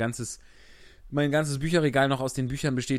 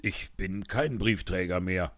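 lower, slow, blunt postman voice; gruff postman; 5 semitones lower than usual. Acted. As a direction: say it dryly as a fed-up postman. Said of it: "sagte er zum König".

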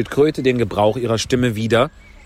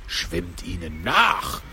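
Gryphon; 5 semitones lower, 4 dB quieter, much slower than usual. A narrator's reading. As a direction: stretch it deep, a restrained cry. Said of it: "kreischte der Greif". (tiefer, gedehnter Greifenruf)

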